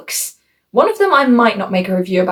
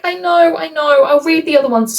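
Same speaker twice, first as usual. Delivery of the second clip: higher-pitched, pleading concession; mock placating; 8 semitones higher than usual. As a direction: act out giving in to a scolding friend. Placating the outraged friend in retelling.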